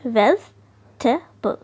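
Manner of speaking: Vegetable slowly in three parts, VEJ - tuh - bul; syllable breaking. Shown as a demonstration. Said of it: "का जो syllable breaking होगा ऐसे होगा।".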